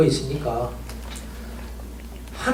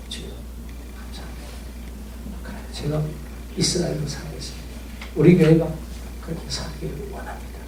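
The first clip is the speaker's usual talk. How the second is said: whispered, much softer thanks to God; hushed thankful prayer; 2 semitones lower, 7 dB quieter than usual. Retold or imitated. A pastor whispers his thanks to God after finding peace. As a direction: breathe the prayer almost silently.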